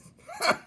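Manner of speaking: high gleeful villain giggle; evil giggle. Acted. Old rat giggles at his own cruel joke, evil giggle. Villain chuckles mid-lesson.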